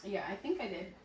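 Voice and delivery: ordinary delivery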